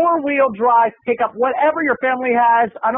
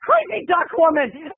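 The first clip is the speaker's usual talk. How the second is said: high startled cry, then slower on the name; startled mock scream; 10 semitones higher, much slower than usual. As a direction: shriek as if ambushed in the laundromat. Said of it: "I almost screamed, almost dropped my laundry".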